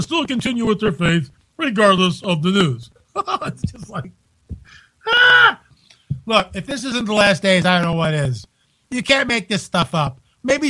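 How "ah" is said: exasperated yell. Real, not acted. Exasperated outburst.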